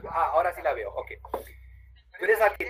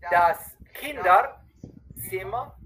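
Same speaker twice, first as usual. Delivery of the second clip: Kinderzimmer drawn out, rising at the end; slow, clear model take; 2 semitones higher, much slower than usual. Shown as a demonstration. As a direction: stretch every sound so the learner hears it. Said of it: "una vez bien despacito, bien claro".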